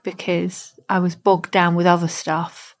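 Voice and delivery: ordinary talk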